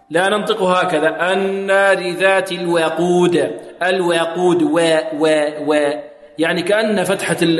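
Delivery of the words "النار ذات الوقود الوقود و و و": الوقود with the a tilted toward e, "al-wequd"; over-thinned waw. Shown as a demonstration. Teacher shows what not to do, "we" in الوقود. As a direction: tint the waw of الوقود with a y sound, like "we". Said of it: "تصير الواو كأنها مشربة بياء".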